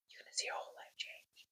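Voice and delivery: breathy whisper, almost no voice. Acted. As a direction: let the last words fall into a whisper, like a secret. Whispered line ending the teaching point.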